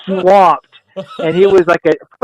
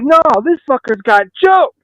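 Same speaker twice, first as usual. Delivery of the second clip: higher-pitched acted indignation; retold indignant outburst; 8 semitones higher than usual. Waiter to colleagues pulling him away, retold indignation. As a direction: protest while being dragged off.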